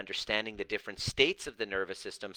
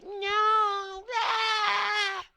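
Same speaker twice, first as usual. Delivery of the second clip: put-on high, loud childish wailing no; a hammed-up crying kid refusing; mock toddler tantrum; 5 dB louder than usual. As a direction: throw a mock baby fit. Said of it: "that's basically what you sound like".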